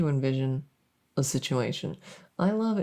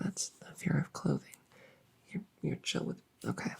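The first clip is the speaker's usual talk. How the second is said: deflated whisper; 10 dB quieter than usual. Real, not acted. Disappointed aside after no reaction.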